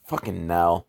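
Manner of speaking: ordinary talk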